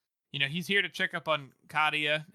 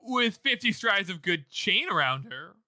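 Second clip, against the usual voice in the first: slow, louder, hammed-up fibbing; unconvincing liar; 5 semitones higher, 6 dB louder, slower than usual. He embroiders where she is, mock bad lying. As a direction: drag out the obvious fib. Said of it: "sounds like a Scooby-Doo character trying not to lie".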